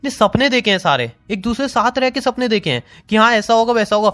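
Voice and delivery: high, hurt and heated pleading argument; heated hurt protest. Real, not acted. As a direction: argue back, wounded and worked up. Heated argument with leaving girlfriend.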